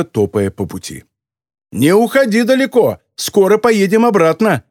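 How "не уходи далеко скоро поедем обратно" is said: high-pitched shout after someone, moderate volume; calling out. A narrator's reading. Man shouts to the departing girl, calling out. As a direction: call after someone walking off. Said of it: "крикнул Бэнкрофт".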